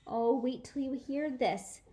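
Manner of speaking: plain reading voice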